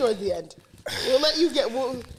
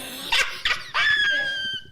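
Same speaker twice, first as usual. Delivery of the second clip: shrieking laugh. Real, not acted. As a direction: crack up mid-yell. Shouting laugh at push-ups.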